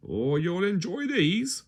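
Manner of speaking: a hint of a jolly butcher; smiling butcher. A narrator's reading. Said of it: "smiled Mister Double Chop".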